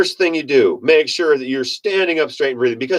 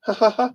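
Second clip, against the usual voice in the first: high mocking ha ha ha; short mock laugh; mock villain laugh; 7 semitones higher than usual. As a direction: cackle like a smug manipulator. Mocking laugh after quote.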